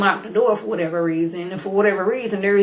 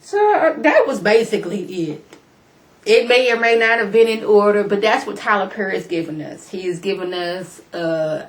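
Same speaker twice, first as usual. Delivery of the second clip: usual speaking voice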